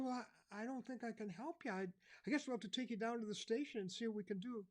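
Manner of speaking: an officer at a loss; officer unsure how to help a lost boy; policeman. Retold or imitated. Policeman voiced in story.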